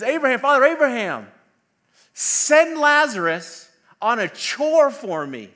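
high, loud, imperious mock command; proud rich man. Rich man in hell ordering Abraham, haughty mock command. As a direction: order a servant about, grandly drawn out. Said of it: "views himself as this proud man".

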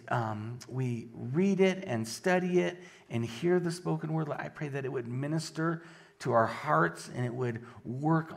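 low, soft praying voice; prayer. Real, not acted. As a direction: pray quietly and unhurriedly to God. Opens with a prayer.

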